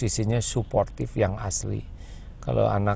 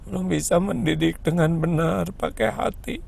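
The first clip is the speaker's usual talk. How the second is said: high, rising to a choked near-sob plea for forgiveness; tearful prayer; 6 semitones higher than usual. Retold or imitated. Mother praying for forgiveness while hugging her child, much higher and breaking. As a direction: pray for forgiveness, breaking toward tears. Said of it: "tapi bicara ke Allah".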